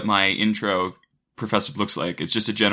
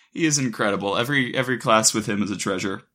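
usual speaking voice

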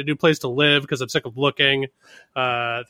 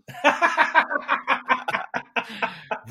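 long, high-pitched laugh; 9 semitones higher than usual. Real, not acted. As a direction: burst into a sustained laugh at your own joke. Laughing at own cigarette joke.

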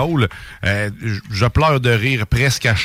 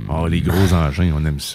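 much lower, deep he-man voice; deep macho voice; 10 semitones lower than usual. Acted. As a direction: growl it like a tough guy. Mock macho remark about rockets.

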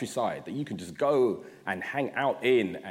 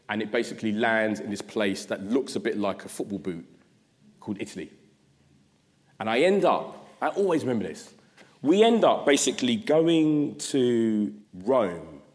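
usual speaking voice

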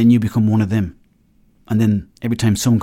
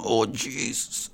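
hungover groan; 10 dB quieter, much slower than usual. Retold or imitated. Hungover going into work Monday.

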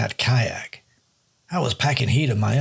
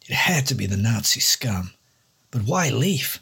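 ordinary delivery

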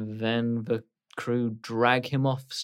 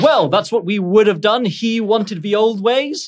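put-on louder, gruff captain, slowing on the old ways; stubborn pirate captain defending himself; 8 semitones higher, 8 dB louder than usual. Captain insists on the old ways to the crew. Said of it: "He says".

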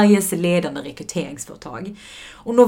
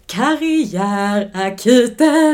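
held-back shout, drawn out and higher; half-hearted shout; 5 semitones higher, 7 dB louder, much slower than usual. Pretend. Podcast host announcing the show, timid half-shout. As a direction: call it out slowly, not daring to scream fully. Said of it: "vågar typ inte skrika riktigt".

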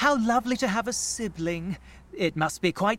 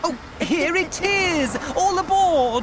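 high, loud call of arrival; excited call; 7 semitones higher, 4 dB louder, slower than usual. Acted. Interviewer greeting the arriving party bus, excited. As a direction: sing it out to everyone boarding.

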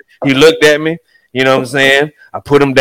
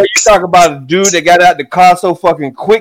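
ordinary delivery

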